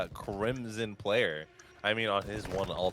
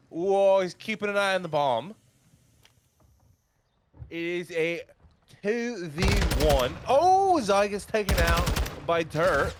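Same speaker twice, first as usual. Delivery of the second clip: higher-pitched mock English accent, sounding Australian; bad British accent; 8 semitones higher than usual. Commentating the bomb play in a high, Australian-sounding English accent. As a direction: call the play in a badly faked British accent. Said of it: "hard for me to do an English accent".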